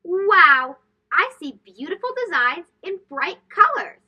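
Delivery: loud delighted gasp of wonder; amazed wow. A narrator's reading. Narrator marvelling to children at bright henna designs. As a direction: burst out in wonder at the colours.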